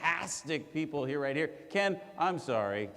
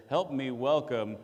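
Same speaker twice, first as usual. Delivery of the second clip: ordinary delivery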